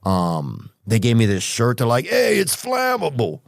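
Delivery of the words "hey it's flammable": higher mock firefighter joke; joking firefighter. Firefighter joking with the guest, higher and playful. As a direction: deliver the joke with a grin.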